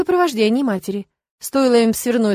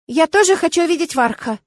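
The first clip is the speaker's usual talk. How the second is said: put-on high-pitched sulky little boy; childish, demanding complaint; pouting child; 8 semitones higher, 3 dB louder than usual. A narrator's reading. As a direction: whine with a pout. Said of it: "надул губы Арго".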